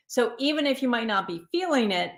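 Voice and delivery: ordinary delivery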